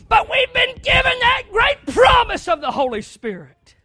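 loud, high, shouting sermon voice; full-force preaching. Acted. Preacher shouting to his church about rejecting truth, full-force preaching. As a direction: drive it home from the pulpit.

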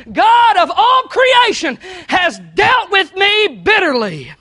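slow, loud, high and bitter cry; bitter outcry. Acted. Naomi crying out against God, slow and loud. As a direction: drag out every word of the grievance. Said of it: "She said".

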